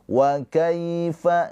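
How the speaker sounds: Wa kaifa, the ya slid softly and short; soft ya sukun. Shown as a demonstration. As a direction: ease gently through the ya of kaifa. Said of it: "kalau ini dilembutkan aja".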